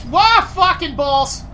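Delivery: loud yelp into a curse; startled shout. Real, not acted. Player surprised by the creature, startled shout. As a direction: jump and swear in shock.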